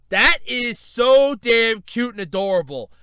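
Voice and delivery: loud, high, drawn-out gushing; real gushing. Real, not acted. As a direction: gush slowly and loudly over something adorable. Gushing over ramen coma picture.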